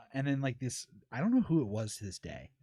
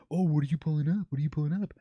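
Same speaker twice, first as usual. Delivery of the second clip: louder, put-on nosy questioning; imitating weirded-out chatters; 3 semitones higher, 6 dB louder than usual. Chatters seeing their profiles pulled up, mock alarmed questioning. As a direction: mimic chatters suddenly getting weird. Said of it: "they got all weird".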